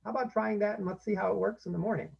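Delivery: encouraging parent. Shown as a demonstration. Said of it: "And say".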